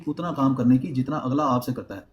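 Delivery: usual speaking voice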